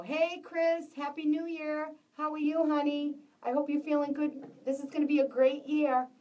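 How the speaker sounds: light up as a friend arrives; cheerful greeting. Real, not acted. Greeting viewer Chris warmly.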